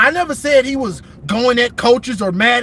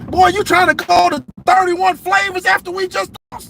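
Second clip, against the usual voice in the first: pitched up, incredulous scolding of a player; mock scolding coach; 7 semitones higher than usual. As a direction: scold him like a coach who can't believe it. Scolding a player after a loss.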